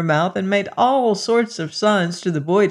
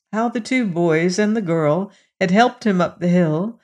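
ordinary delivery, nothing marked

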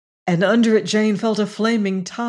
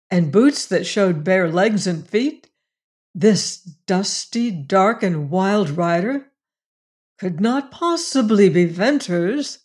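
usual speaking voice

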